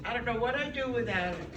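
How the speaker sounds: usual speaking voice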